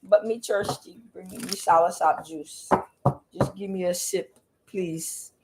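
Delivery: mock Jamaican patois, slow and pleading; half-joking Jamaican accent begging for a sip. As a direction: Jamaican patois put on lightly. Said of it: "I'm not doing an accent today".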